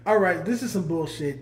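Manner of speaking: ordinary talk, nothing marked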